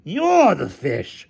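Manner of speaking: high, slow, emphatic retort; shouted accusation. Acted. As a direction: throw the accusation back, drawn out. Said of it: "shouted Hamilton".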